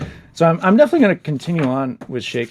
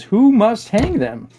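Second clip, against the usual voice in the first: put-on higher, slow little boy's question; child's voice; 9 semitones higher, 4 dB louder, slower than usual. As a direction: ask it wide-eyed like a small kid. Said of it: "The son goes".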